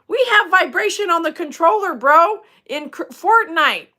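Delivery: high, loud outburst; excited exclamation. Real, not acted. Gamer answering a viewer about vibration, excited and loud. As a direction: burst out excitedly to a bro.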